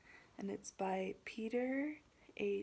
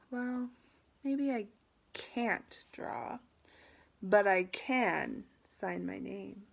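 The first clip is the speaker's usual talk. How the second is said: halting start, building to a firmer finish; hesitant then determined; 7 dB louder, slower than usual. Acted. Pupil thought for a moment, mildly defiant. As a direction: think it over, then assert it. Pupil answers teacher in story.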